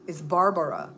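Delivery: "Barbara" with three syllables, bar-ba-ra; Belizean Barbara. Shown as a demonstration. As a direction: say "Barbara" as she does. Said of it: "My name is not B A R B R A".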